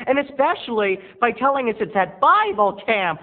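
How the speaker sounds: imagined viewer scolding Terry, high and loud; annoyed viewer. Pretend. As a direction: nag him like a fed-up viewer. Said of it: "But you're saying to yourself".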